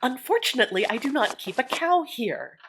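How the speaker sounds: a touch of tart sarcasm; tartly. A narrator's reading. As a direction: snap back with dry sarcasm. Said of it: "Natty replied tartly".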